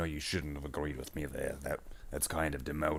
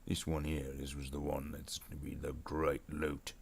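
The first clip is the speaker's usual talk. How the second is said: gruff-talking orc boss, well below the sidekick; 4 dB quieter than usual. Pretend. Streamer as orc boss sizing up loot, orc voice. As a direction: play an orc boss addressing his crew. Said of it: "doing this orc voice".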